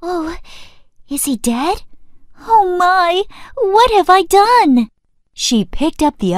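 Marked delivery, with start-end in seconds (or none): 0.0-1.8 soft worried gasp, high; hushed fear over the frog. Acted. Princess fearing the frog is dead, soft and worried. 2.5-4.9 high slow dismay; dismayed princess. Acted. Princess over the still frog, high slow dismay.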